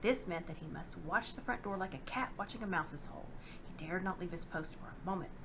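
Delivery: usual speaking voice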